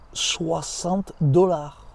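very slow, rough Russian accent imitation; name the price slowly as a Russian mechanic; attempted Russian accent. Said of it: "avec l'accent russe". (accent russe imité, très lent)

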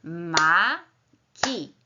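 "Maki" as two beats, ma - ki, with a long gap; split into syllables. Shown as a demonstration. Teacher answering how many syllables, "Maki" syllable by syllable. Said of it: "Podzielmy teraz każdy z tych wyrazów na sylaby".

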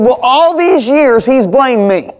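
high, drawn-out complaint, imitated; aggrieved outburst. Wounded woman telling pastor of her ex-husband's blame, aggrieved.

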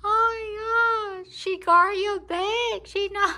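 high, loud and slow; crying-laugh excitement; excited squeal. Real, not acted. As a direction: gush over the cat. Excited over cat guarding bag.

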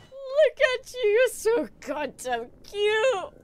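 high, slow cooing at a pet. Real, not acted. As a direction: sing the name out like to a kitten. Gushing over a cute creature.

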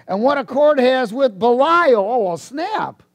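forceful preaching. Real, not acted. Preacher reading scripture to congregation, full force. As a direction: punch out the verse to the congregation.

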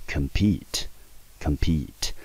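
compete with the stress on PETE; stress on second syllable. Shown as a demonstration. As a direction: say compete carefully, light COM, strong PETE. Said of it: "中音节就落在第二个音节".

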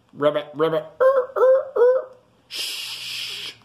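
ribbits leaping to high squeaks; croak, squeak high, then a long shush; froggies. A narrator's reading. Froggies shush the sleeper, ribbiting and squeaking. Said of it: "says the froggies".

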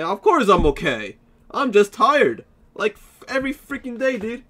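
high, loud exasperated complaint; exasperated outburst. Real, not acted. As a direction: vent about being tired every day. Asked if he is okay.

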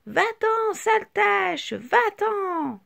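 chorus shout. A narrator's reading. The shapes yell at Petite Tache to leave, loud. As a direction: chase her off yelling. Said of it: "tous se mettent à crier".

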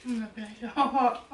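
chewing while talking; talking between bites; mouth full. Real, not acted. Seen 1: all three eat chocolate; speaker unclear, mouths move chewing.

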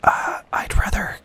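hushed game whisper; leaning in to whisper. Real, not acted. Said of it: "get my whisper voice on".

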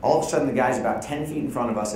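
usual speaking voice

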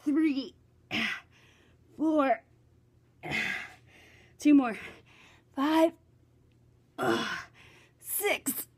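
worn-out counting through push ups; exhausted push ups. Real, not acted. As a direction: force out each count, arms giving out. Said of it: "my body's giving out".

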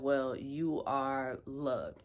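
very slow, measured parting reply; deliberate retort. Retold or imitated. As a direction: stretch each word out with calm finality. Retelling her deliberate parting reply.

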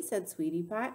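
plain reading voice

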